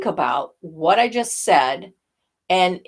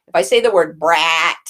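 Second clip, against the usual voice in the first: edgy sound; 3 semitones lower, 5 dB louder than usual. Shown as a demonstration. Voice coach showing an edgy brat. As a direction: put an edge on brat, pressed and harder. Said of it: "the edge sound that I'm looking for".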